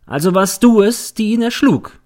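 high, loud accusing question; pointed accusation. A narrator's reading. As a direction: pounce on the witness with the charge. Defence lawyer accuses the girl.

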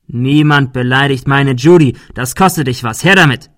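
loud, menacing demand; threaten, building to a loud order; angry threat. A narrator's reading. Bully demands the girl's money, angry.

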